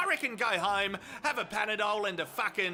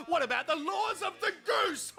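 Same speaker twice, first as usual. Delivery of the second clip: high shouted outraged goose; shouted goose protest, much higher; indignant goose cry; 8 semitones higher than usual. Acted. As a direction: squawk the injustice as the goose.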